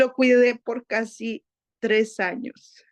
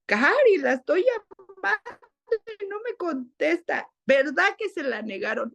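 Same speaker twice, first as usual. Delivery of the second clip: imitated pressing employer; 6 semitones higher than usual. Employer phones, pressing for visa news.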